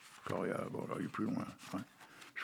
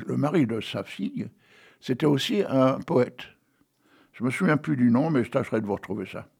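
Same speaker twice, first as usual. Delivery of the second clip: normal talking voice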